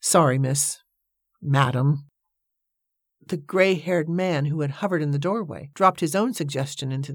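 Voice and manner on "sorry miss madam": sheepish. A narrator's reading. He apologizes to the lady for swearing, sheepishly. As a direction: apologize awkwardly, pausing to correct yourself. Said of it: "grinning sheepishly".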